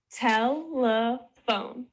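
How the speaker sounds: "telephone" slowly split as tel, e, phone; three stretched, separate beats in "telephone"; syllable by syllable. Shown as a demonstration. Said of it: "three syllables in each of the words".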